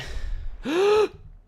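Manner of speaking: high, drawn-out intake of breath. Pretend. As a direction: suck in air before a dive. Diving, needs air.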